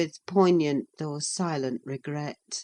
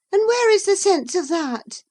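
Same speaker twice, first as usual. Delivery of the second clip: high, loud peevish scoff; 7 dB louder than usual. Acted. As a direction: sneer at it in a high, cross voice. Catherine scoffs.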